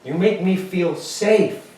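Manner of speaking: hammed-up tender confession to brother; overacted heartfelt line; mock tender.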